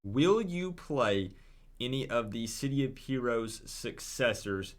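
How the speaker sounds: ordinary talk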